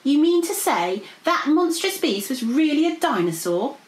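high, squeaky incredulous voice; jabbering pixie. A narrator's reading. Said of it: "jabbered Arthur".

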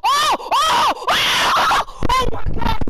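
high, loud shrieks of disbelief; real overjoyed shouting; ecstatic screaming. Real, not acted. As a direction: scream like you just hit the jackpot. Just got the rarest card.

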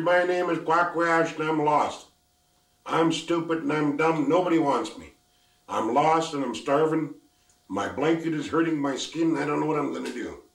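high, loud, self-pitying complaint; lost girl lament. Acted. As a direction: list your woes, sorry for yourself.

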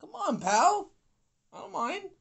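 high teasing taunt at the quitter; mock taunting. Real, not acted. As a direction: needle him with fake sweetness. Taunting opponent who quit.

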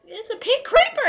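high, loud fake-panicked cry; joking panicked shout at a pink creeper; mock scared yell. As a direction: shriek it in pretend terror at the game. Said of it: "I'm kidding".

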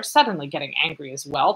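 ordinary talk

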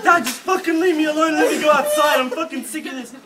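high, loud mock outburst at a parent; re-enacted fed-up yelling; exasperated retort. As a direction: shout back like a fed-up son. Acted son snapping back at dad.

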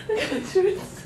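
breaking up with laughter mid-line; laughing through it. Real, not acted. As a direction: try to stay serious but crack up.

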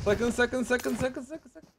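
sakın repeated, fading to a breath; tense pleading. Real, not acted. Hiding from an enemy, pleading under breath. Enemy close, begging not seen.

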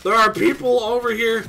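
loud, high warning; insistent shout. Real, not acted. Gamer warns teammates about people nearby, insistent loud shout. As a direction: shout it high, hammering each word.

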